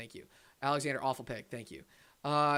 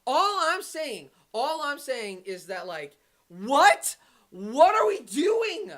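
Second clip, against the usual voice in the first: high, drawn-out incredulous whine; real exasperation climbing high; exasperated; 9 semitones higher, much slower than usual. Real, not acted. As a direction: stretch the words out in high-pitched disbelief. Exasperated complaint about the team's pick.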